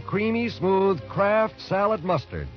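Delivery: ordinary talk, nothing marked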